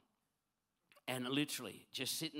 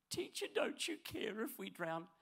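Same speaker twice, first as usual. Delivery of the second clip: a touch of a whiny, timid plea; mildly whimpering like a scared disciple; wimpish prayer; 7 semitones higher than usual. Retold or imitated. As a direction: disciple whining to Jesus in a wimpy voice. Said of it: "sound a little bit wimpish".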